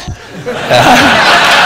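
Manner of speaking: high, loud burst of real laughter. Real, not acted. Laughing hard at his own story of being caught quitting.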